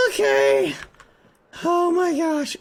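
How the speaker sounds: high-pitched, hammed-up exasperation; mock overwhelmed. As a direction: play overwhelmed in a squeaky little voice.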